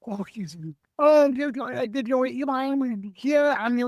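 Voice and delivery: high, quick excited local driver. Retold or imitated. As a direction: mimic the driver blurting it out fast and high. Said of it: "He was like, quick talking".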